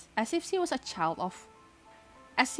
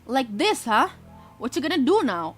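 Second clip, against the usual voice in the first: taunting bully; 5 semitones higher, 5 dB louder than usual. Acted.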